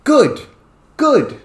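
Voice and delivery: high, loud, repeated classroom calls; sudden call-outs. Shown as a demonstration. Teacher calls for thumbs up, high and loud. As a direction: fling the word out to the whole class. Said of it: "you can just literally go".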